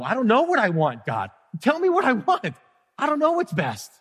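high, pleading cry to God; pleading prayer. Retold or imitated. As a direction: beg like a helpless child. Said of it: "I'm like".

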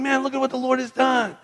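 high-pitched, acted wonder; excited high-voiced praise; amazed rejoicing. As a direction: marvel aloud to your friends, pitched high. Healed group marvels together.

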